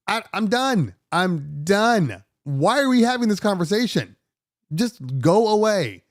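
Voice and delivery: exasperated, pitch up, dragging out go away; fed-up guest snapping at hotel security. Retold or imitated. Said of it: "I'm like".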